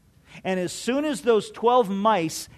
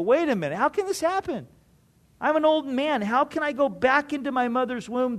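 put-on higher, puzzled old man asking how; bewildered Nicodemus; 6 semitones higher, faster than usual. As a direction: voice a confused old man questioning.

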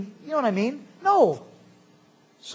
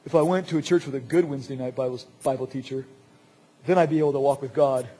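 lightly voiced whiner with a lower pitch; mock excuse-maker; 4 semitones lower, faster than usual. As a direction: make a lame excuse in a put-on voice. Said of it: "you can give the excuse".

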